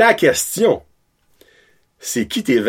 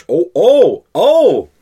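high, loud "oh, oh!"; surprised outburst; 5 semitones higher, 5 dB louder, much slower than usual. Real, not acted. Co-host reacts to the other's roster pick, surprised outburst. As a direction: cry out in rising surprise, louder each time.

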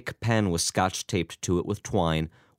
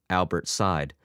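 usual speaking voice

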